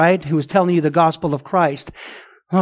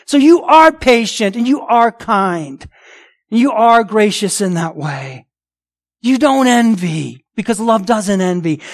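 forceful preaching; 3 semitones higher, 4 dB louder than usual. Real, not acted.

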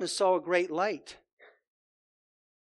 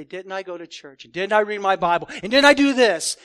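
indignant churchgoer; 9 dB louder than usual. Acted. Churchgoer pleading before the Lord, building to a loud protest. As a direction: plead your case, getting louder each question. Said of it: "stand before the Lord and say".

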